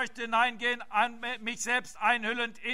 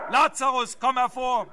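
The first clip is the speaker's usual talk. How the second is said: shouted command; 5 dB louder than usual. Retold or imitated. Preacher voicing Christ at the tomb, shouting. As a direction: call the dead man out with full authority. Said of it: "Er schrie".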